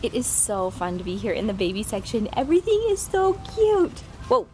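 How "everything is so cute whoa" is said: gushing. Real, not acted. Gushing in baby section.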